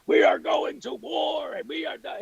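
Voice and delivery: high-pitched mock battle shout; angry Cenk Uygur. Podcaster mocking an angry rival host, mock shout. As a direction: holler it high, as a mocking war cry. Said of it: "his badge of honor was that he was angry".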